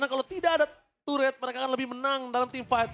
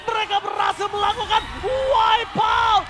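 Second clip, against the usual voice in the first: fighting game announcer; 5 semitones higher, slower than usual. Real, not acted. Caster calls the wipe out.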